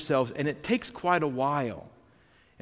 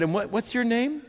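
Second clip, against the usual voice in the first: high, lifted question to a hard-of-hearing man; high asking voice; kindly asking; 9 semitones higher than usual. Retold or imitated. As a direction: pitch it up to be heard. Asking resident his name.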